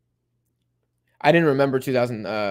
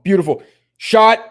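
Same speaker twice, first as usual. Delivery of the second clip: loud, much higher live shout; excited call; 8 semitones higher, 10 dB louder than usual. Real, not acted. Streamer calling the attack live: loud excited shout. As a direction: call the shot as it flies.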